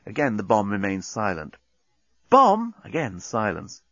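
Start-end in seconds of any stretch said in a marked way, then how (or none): 2.3-2.7 urgent call. Acted. Nettie calls bomb.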